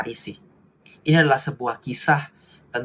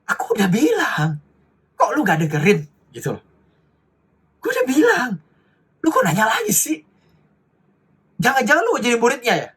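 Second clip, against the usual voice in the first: high, mocking Jakarta slang voice of the blind man; cheeky retort; 9 semitones higher than usual. Preacher plays the blind man snapping back at the Pharisees, cheeky and high. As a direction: sass them like a fed-up know-it-all. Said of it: "menjawab agak sedikit nyolot ya".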